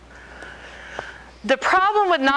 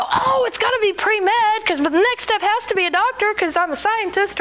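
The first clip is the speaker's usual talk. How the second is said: higher-pitched mock eager reply; eager know-it-all; 9 semitones higher than usual. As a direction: play yourself gushing, pitched up. Acts out her eager past reply to God.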